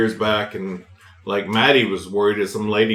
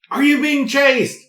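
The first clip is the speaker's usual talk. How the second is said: retold yell; slower than usual. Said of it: "And then I was like".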